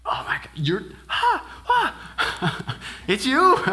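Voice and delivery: breathless shock bursting into delighted laughter; amazed recognition. Retold or imitated. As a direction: disciples suddenly recognizing Jesus, whisper to laughing shout. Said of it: "they're like".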